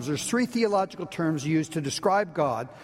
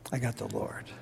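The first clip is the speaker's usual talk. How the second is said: softer confession; 8 semitones lower, 7 dB quieter than usual. Shown as a demonstration. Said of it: "Can you say that?".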